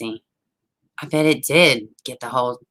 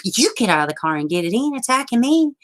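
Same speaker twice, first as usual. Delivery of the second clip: quick, high protest; mock-outraged comeback; indignant retort; 9 semitones higher, faster than usual. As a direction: fire it back fast, rising high. Indignant retort to son, retold.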